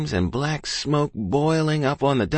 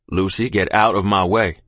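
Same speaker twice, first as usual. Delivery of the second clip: lower, louder father's command; firm order; 4 semitones lower, 5 dB louder than usual. A narrator's reading. Husband orders wife out of his way, firm. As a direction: order her aside in a low, firm voice.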